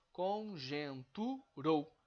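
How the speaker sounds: conjunturou slow and loud, final rou in one beat; syllable by syllable. Shown as a demonstration. Teacher proving rou is one emission, conjunturou slowly syllabified. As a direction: break up con-jun-tu, then rou as one. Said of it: "ele está sendo pronunciado em uma emissão só".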